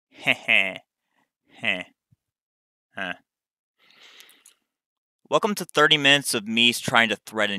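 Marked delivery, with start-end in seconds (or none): 0.1-3.1 snickering. Real, not acted. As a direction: snicker at your own boast. Laughs at own needle threading boast.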